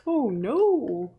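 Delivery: soft, drawn-out dismay; a quiet oh-no of dismay; dismayed frog. Acted. Frog scooped up in a jar, dismayed. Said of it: "the frog's face says".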